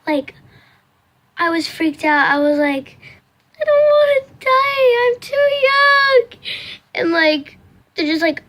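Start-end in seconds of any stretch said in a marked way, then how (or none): 3.6-6.2 high, louder panicked whine, lightly hammed-up; a mock-terrified kid pleading; scared wail. Girl told she could die, hammed-up frightened wail.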